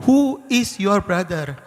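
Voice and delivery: loud, slow, high retold demand; demanding question. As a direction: press for an answer word by word.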